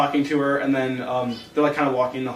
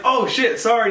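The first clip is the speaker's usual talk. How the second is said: mock flustered apology; 6 semitones higher than usual. Poe walks in on her undressed.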